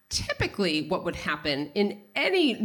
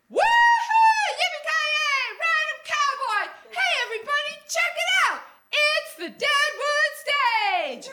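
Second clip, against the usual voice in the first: hammed-up Southern belle; 4 dB louder than usual. Ad-libbing her line to a laughing chorus, hammed-up Southern belle holler. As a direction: whoop it up like a Southern belle on stage. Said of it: "I channel my inner Delta Burke".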